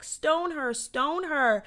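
high-pitched imitation of a jeering crowd; mock crowd yell. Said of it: "screaming these terrible things".

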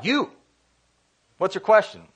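a mild mock teacher call; calling on a student. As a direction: put one student on the spot.

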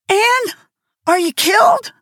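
high, loud panicked cry; shrieked. A narrator's reading. Diana kneeling by fallen Anne, high frightened shriek. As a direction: shriek her name in terror. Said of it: "shrieked Diana".

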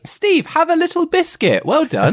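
very high, cooing reward voice; mock praise. Host rewards Steve for a right answer, high mock-praising voice. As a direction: praise him as if handing a treat to a pet.

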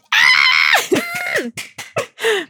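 high screaming laughter; shrieking laugh. Real, not acted. Co-host shrieks with laughter at the joke. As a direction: burst into squealing laughter.

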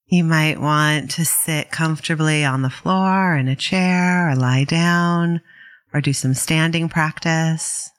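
calm, unhurried meditation guidance with long pauses; guided meditation. Real, not acted. Meditation teacher guiding listeners to settle in, calm and unhurried. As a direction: ease listeners into settling, leaving space between phrases.

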